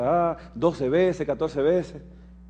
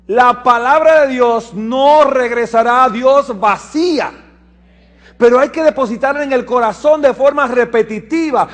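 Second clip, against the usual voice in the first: fervent preaching; 9 semitones higher, 9 dB louder than usual. Acted. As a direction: drive the message home. Predica con fuerza. (predicación fervorosa, alta y fuerte)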